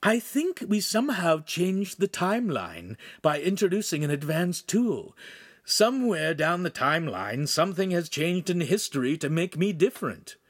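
high, earnest character explaining; high-pitched story character voice; Pan's character voice. Acted.

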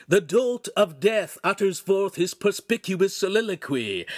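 high, loud mock proclamation; grandly theatrical herald; shouting. A narrator's reading. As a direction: announce him with pompous scorn. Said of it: "Pan shouted".

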